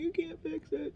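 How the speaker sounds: very high whiny protest; high wailing denial to her son; mock despair. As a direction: wail it like a hopeless child. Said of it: "And I'm like".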